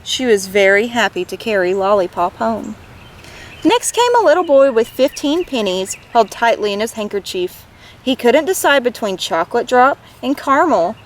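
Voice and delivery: put-on gentle read-aloud for children; lively picture-book reading; story narration. A narrator's reading. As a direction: tell it like bedtime storytime.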